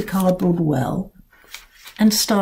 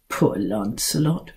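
lower, softer sarcastic repeat; mocking echo; 8 semitones lower, 8 dB quieter than usual. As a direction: mimic them with scorn. Cat mocking the pity.

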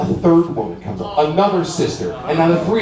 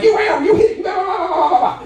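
both women yelling; faster than usual. Retold or imitated. Sisters yell at the driver outside, high hammed-up shouting. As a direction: imitate two women shrieking over each other. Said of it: "are both yelling at me".

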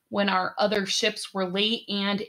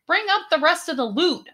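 higher, commanding call to the crew; order; 9 semitones higher, 4 dB louder, faster than usual. A narrator's reading. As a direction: bark the order across the deck. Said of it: "she ordered".

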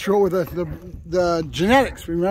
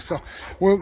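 usual speaking voice

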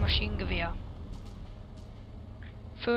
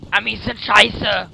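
loud taunt; 10 dB louder, much slower than usual. Pretend. Gamer taunt, slow loud.

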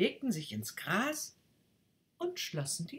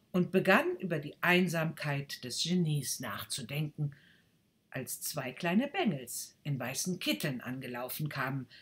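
usual speaking voice

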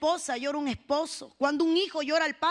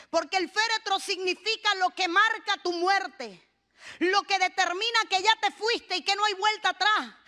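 ordinary talk, nothing marked

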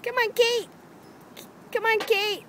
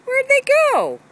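high, loud puzzled call; calling out to the missing dogs; searching for the dogs; 12 semitones higher, 7 dB louder than usual. Real, not acted. Looking for the dogs.

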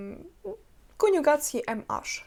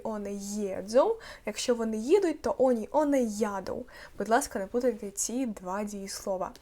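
ordinary talk

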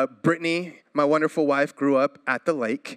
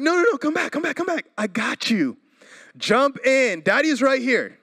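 much higher, urgent coaxing; pleading new dad; 9 semitones higher than usual. Retold or imitated. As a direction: call the kids back to jump to Daddy. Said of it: "I'm like".

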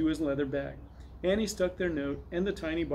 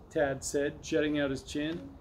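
ordinary delivery

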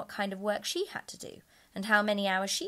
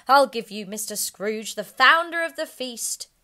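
hearty toast; 6 semitones higher, 12 dB louder than usual. A narrator's reading. Bob proposing a toast.

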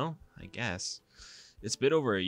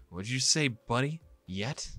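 menacing challenge; 5 semitones higher, 4 dB louder than usual. Acted. Jisoo threatens Heejay.

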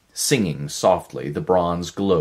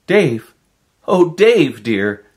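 much higher and louder, a relieved cry; cried out; 11 semitones higher, 11 dB louder, slower than usual. Acted. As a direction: call out his name with overflowing relief. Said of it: "Someone cried out".

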